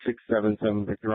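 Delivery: normal talking voice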